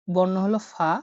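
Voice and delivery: ordinary delivery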